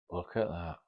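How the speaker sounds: hushed, amazed murmur; awed. Retold or imitated. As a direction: retell her stunned first look, softly. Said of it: "her eyes and her mouth, like".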